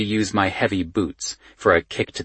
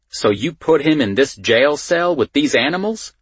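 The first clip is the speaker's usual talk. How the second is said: higher-pitched outraged question; incredulous scolding; 7 semitones higher, 3 dB louder than usual. A narrator's reading. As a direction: scold the deputy in disbelief. Boss scolds deputy over mix-up.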